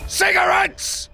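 high, drawn-out gleeful cry; triumphant shout. Real, not acted. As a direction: shout it out, savouring the catch. Spots contraband cigarettes.

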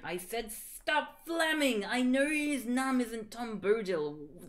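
slow, deliberate mock-stern read; mock author's note. Reading the fic's note to haters, slow and mocking. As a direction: drag it out like an annoyed author.

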